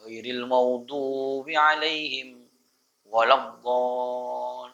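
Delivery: drawn out, chanted; measured recitation of Fatihah; slow Quranic recitation. Shown as a demonstration. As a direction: recite slowly like prayer.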